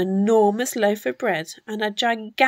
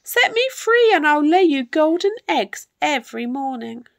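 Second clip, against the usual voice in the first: high, excited hen's plea; high clucky storybook bird; clucking hen; 6 semitones higher, 4 dB louder, faster than usual. A narrator's reading. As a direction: bargain urgently like a hen. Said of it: "he clucked".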